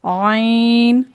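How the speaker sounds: आइन drawn out very slowly from the throat; slow throaty ain. Shown as a demonstration. Teacher drawing out ain for the class. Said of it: "ऐसे नहीं पढ़ना है".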